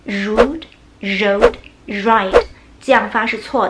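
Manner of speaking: rude, road, ride with air buzzing at the teeth like Chinese ri; slow deliberately wrong rude road ride; wrong r demo. Shown as a demonstration. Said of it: "这样发是错的".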